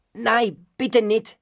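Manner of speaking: put-on high, urgent child's plea; pleading cry. A narrator's reading. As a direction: cry out to stop them. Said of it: "rief ich".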